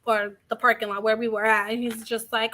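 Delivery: normal talking voice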